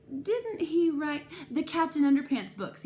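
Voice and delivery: be scandalised; indignant question. A narrator's reading. Mrs Spencer indignant about the banned author.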